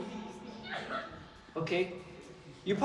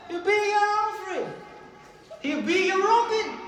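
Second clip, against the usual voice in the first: excited; 9 semitones higher, slower than usual. Acted. Excited quote, high pitch.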